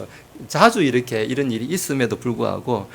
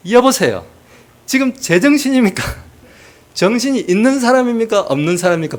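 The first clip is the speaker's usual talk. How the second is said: loud, much higher Gyeongsang scolding; angry Gyeongsang caller; 10 semitones higher, 7 dB louder than usual. Retold or imitated. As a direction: berate a stranger in thick Gyeongsang dialect. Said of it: "경상도 말로 막 뭐라".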